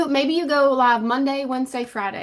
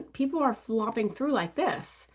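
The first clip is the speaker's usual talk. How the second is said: usual speaking voice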